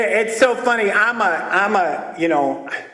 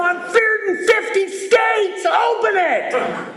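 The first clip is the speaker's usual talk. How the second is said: high slow furious mock scream; screaming crazy; 12 semitones higher, slower than usual. Pounding on the door, dad screams high and slow. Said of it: "Just screaming crazy".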